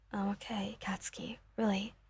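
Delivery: soft, breathy whisper of comfort; hushed reassuring whisper; whispered reassurance. Acted. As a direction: whisper to calm him down. Reassuring him, head on shoulder.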